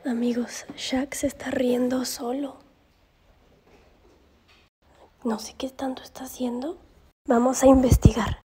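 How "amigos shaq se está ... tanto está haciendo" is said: hushed, secretive whisper; conspiratorial whisper. Real, not acted. Vlogger spying on her laughing dog, secretive whisper. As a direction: whisper to viewers while sneaking up.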